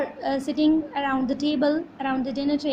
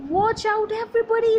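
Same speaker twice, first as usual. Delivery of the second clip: calling out warning; 7 semitones higher, faster than usual. Acted. Father warning family at the table, calling out. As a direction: warn everyone at the table. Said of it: "he turns to us and he says".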